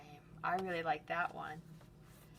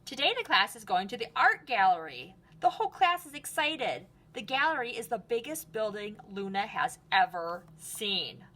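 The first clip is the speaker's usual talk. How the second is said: lively storybook read-aloud; reading to children; 4 dB louder than usual. Acted. Teacher reading Luna's story to the class, lively. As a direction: read it brightly for a class of kids. Reads picture book aloud.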